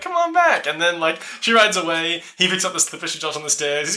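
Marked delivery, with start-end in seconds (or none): 0.0-0.7 calling out. Retold or imitated. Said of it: "He's like".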